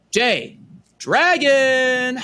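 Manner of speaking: high, loud, drawn-out ring announcer call; wrestler intro. Acted. Host introducing his guest, loud, drawn-out wrestling announcer call. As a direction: stretch the name out like announcing a champion. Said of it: "your wrestler intro".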